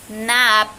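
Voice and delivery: "Naap" loud, long vowel shut off by the lips; final lip-closed consonant. Shown as a demonstration. Teacher showing B word-finally, "Naap" drawn out loudly. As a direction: stretch "Naap" and close the mouth on the end. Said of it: "un sonido con la boca".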